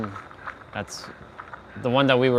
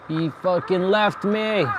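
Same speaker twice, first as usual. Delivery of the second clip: slower, higher, indignant disbelief; 7 semitones higher, slower than usual. Real, not acted. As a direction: stretch it out in betrayed shock. Teammate abandoned them.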